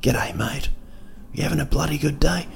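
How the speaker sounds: small, soft voice with rising questions; murmured greeting, like someone perched on your shoulder; little conscience voice. Retold or imitated. Little person on your shoulder, soft conscience voice. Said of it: "your little conscience is speaking like".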